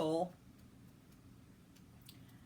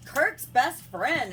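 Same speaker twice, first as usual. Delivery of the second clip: slow, loud and high; horrified revelation; 6 semitones higher, 7 dB louder, much slower than usual. Retold or imitated. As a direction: imitate a friend's aghast announcement. Imitating friend's horrified revelation.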